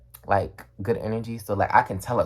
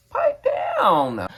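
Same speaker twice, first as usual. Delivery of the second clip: a very high, squeaky mock voice; mock squeaky pipe-down. Worker mocking a rude customer, squeaky high voice. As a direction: squeak it out like a cartoon scold.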